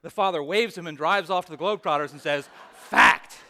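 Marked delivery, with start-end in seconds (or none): 2.7-3.4 loud, clipped fact with a drop; loud parting fact. Retold or imitated. Father mocking his son as he drives off. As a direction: throw it back at him as you leave.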